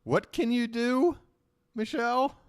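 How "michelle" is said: ordinary delivery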